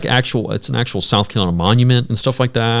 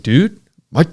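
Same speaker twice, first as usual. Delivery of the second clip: urgent plea; 6 semitones higher than usual. Retold or imitated. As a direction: sell the panic, voice jumping up. Telling scared friend truck is dead.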